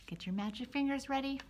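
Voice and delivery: plain reading voice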